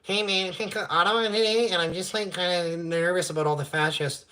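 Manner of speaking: high, lightly voiced nervous nerd; a hint of a jittery streamer; mock nervous streamer. Mocking nervous streamers in Ottawa, lightly voiced. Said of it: "like, just".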